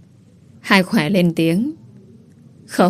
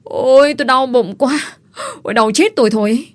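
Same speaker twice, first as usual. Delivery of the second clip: faked pain cries; 5 semitones higher than usual. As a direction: ham up a bellyache for the crowd. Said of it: "Khỏe la làng".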